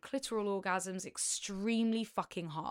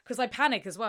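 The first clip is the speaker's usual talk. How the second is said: usual speaking voice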